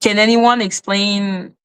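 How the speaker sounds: ordinary delivery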